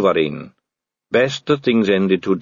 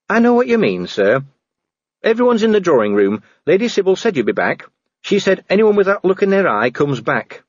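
higher-pitched, respectful subordinate; earnest dwarf officer; 10 semitones higher than usual. Acted. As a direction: speak up earnestly in a raised pitch. Cheery reports to Vimes.

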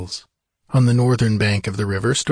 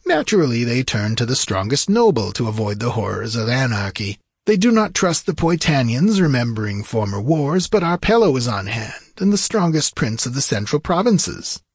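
higher-pitched, theatrical wizard lecturing a king; explaining sorcerer; 3 semitones higher than usual. Acted. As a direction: lay out the politics with swooping emphasis. Narrator voices Peleus explaining.

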